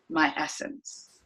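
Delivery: soft, slow and unvoiced; soft tender. Real, not acted. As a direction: let it fall away in a hush. Slow soft closing line.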